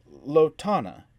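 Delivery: Lotana with the weight on "TA"; stress on second syllable. A narrator's reading. Andrea names her character, lo-TA-na stressed. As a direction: say Lotana, lo-TA-na, leaning on the middle. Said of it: "the accent firmly on the second syllable".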